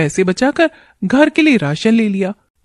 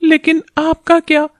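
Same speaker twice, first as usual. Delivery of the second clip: put-on higher, worried young wife; wife's voice; 5 semitones higher than usual. Wife worried about husband's early work, higher wife voice. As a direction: speak as a caring wife fretting over her husband.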